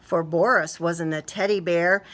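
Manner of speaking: plain reading voice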